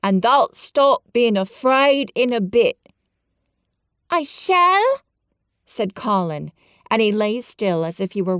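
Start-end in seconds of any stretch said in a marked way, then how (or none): 0.0-2.8 brighter, louder Yorkshire encouragement; renewed cheer. A narrator's reading. Dickon reassures Colin, cheerful. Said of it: "with renewed cheer". 4.1-5.0 high, eager little voice; high determined boy. Acted. Colin answers Dickon, high and determined.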